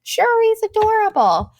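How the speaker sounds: high, slow, sugary gush; mother gushing to the ice cream guy. Retold or imitated. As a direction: coo it sweetly, a bit over the top. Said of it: "I was like".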